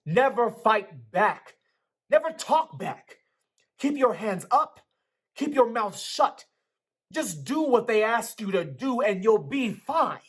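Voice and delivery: father shouting the rules at his son; loud angry lecture. A narrator's reading. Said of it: "His voice began to rise".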